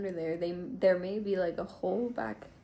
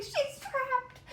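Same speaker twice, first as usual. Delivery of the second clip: much higher mock cry through giggles; laughing squeal. Woman retelling her trapped cat, high giggling squeal. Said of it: "I was like".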